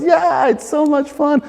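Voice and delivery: put-on high, squeaky voice of a three-year-old daughter; little girl voice. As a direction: imitate your little daughter's happy reply. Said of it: "And she goes".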